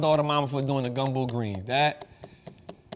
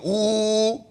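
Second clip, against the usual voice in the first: a raised, lilting ooh; pleased ooh; 5 semitones higher, 4 dB louder than usual. Retold or imitated. Telling Jose about the cornbread, a lilting ooh. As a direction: coo it like a happy little exclamation.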